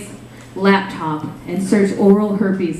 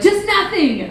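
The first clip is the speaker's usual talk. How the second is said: cranky; 10 semitones higher, slower than usual. Retold or imitated. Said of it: "like, real cranky".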